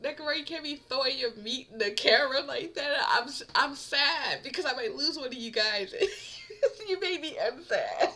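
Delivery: ordinary delivery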